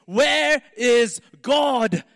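slow, loud, high bellow; furious pastor's booming shout. Retold or imitated. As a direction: roar it right in the boy's face. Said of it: "bellowed in his face".

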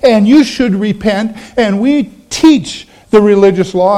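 slow, high-pitched mock sanctimony; accusing Pharisee. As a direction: accuse them from on high, each word drawn out. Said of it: "So the Pharisees are going".

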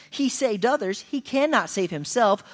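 scoffing priests mocking. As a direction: sneer it to each other. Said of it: "mocked him to one another".

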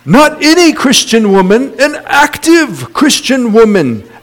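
high-pitched imitation New Zealand accent. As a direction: mimic a New Zealander making a point. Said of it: "New Zealand accent".

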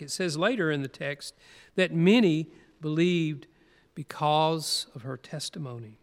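ordinary delivery

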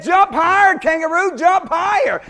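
high, loud taunting chant; kids hollering. Retold or imitated. School kids egging the kangaroo on, loud high shouts. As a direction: egg him on, shouting up at the cage. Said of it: "They said".

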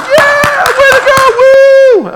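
high, loud whooping crowd cheer, laughing; mock cheering. Preacher mocking youth group cheering after singing, high whoops. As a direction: ham up a rowdy crowd cheer. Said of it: "everybody's like".

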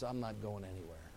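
serene and unafraid; old pastor telling executioners to keep their nails, calmly; calm resolve. Acted. Polycarp calmly at stake.

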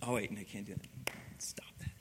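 very soft, low whisper; hushed aside. Acted. Preacher checking himself after the kid act, whispered.

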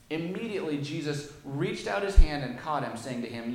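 loud, measured Bible reading to the church; scripture reading. Acted. Preacher reading the gospel aloud to his church. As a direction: read the passage out with weight.

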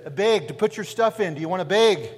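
put-on northern accent. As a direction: insist loudly in a mock northern US accent. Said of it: "they were saying big, like B E G".

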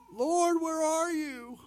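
a mock-desperate plea to God; mock whining prayer. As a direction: wail it like a spoiled complainer. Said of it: "you hit another bump in the road".

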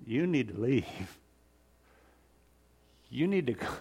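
fearful plea. Acted. Sinful fisherman begging Jesus to leave, hushed and afraid. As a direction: plead quietly, ashamed and afraid.

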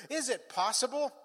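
high, searching question; acted pleading. Believer asking the Father for hope, high pleading.

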